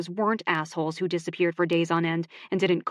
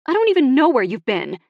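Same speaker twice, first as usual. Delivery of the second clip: much louder, higher, upset; angry outburst; 7 semitones higher, 9 dB louder than usual. Acted. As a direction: let the hurt burst out. Emmy angrily accuses Ox.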